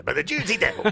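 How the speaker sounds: hammed-up New Jersey accent; mock Jersey twang. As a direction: lean playfully into a Jersey accent. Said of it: "that accent comes out in me".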